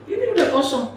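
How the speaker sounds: high-pitched imitation of a woman; insistent woman in line. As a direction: pipe up as the woman calling him.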